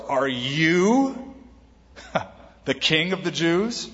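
"you" drawn upward, a pause and a small scoff before the rest; mildly scoffing question, leaning on "you"; stress on YOU. Acted. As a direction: stretch "you" into a question, then laugh lightly. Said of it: "Pilate would ask that question that way".

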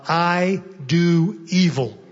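each word drawn out slowly; slow, weighty confession. Retold or imitated. Preacher voicing Paul's words to the congregation, slow and weighty.

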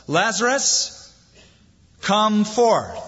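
a high ringing call to the tomb, pitched well up; loud cry. A narrator's reading. Jesus calling Lazarus out of the tomb, high ringing cry. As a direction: call him out of the grave with authority. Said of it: "he cried with a loud voice".

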